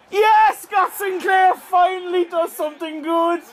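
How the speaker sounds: screaming with joy at a late goal; roaring celebration; ecstatic shout. Real, not acted. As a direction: yell it out, totally overjoyed. Goal celebration while gaming.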